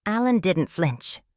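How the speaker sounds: ordinary delivery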